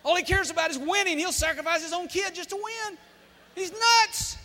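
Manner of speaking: high-pitched, loud mock indignation; outraged mothers. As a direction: imitate scandalised mothers railing at the coach. Outraged mothers imitated, judging coach.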